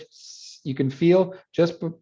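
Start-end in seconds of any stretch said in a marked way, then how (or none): 0.0-0.6 long voiceless s hiss; hissed S sound. Shown as a demonstration. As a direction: hold a bare s so they feel the tongue. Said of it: "if you make an S sound in English".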